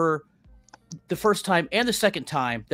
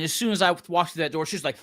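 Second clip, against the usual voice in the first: normal talking voice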